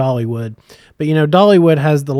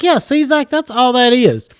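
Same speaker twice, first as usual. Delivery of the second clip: coaxing dad; 10 semitones higher, 4 dB louder than usual. Retold or imitated. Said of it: "I was like".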